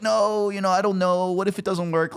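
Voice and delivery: mock a fearful partner's doubts; worried naysayer. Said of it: "we're never like".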